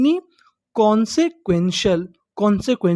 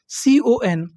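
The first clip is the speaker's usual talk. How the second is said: usual speaking voice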